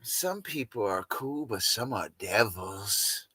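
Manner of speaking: a hint of Nicole, hushed and slow; lightly voiced Nicole; imitating Nicole. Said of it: "That's how she, Nicole would sound".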